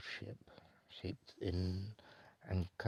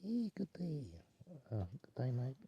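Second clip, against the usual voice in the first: put-on Australian accent; 6 semitones higher than usual. Switches to Aussie greeting.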